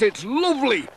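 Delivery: high, bright calling lady's voice on lovely; cheerful lady voice. A narrator's reading. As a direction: call it out gaily from the ice. Woman skating calls happily.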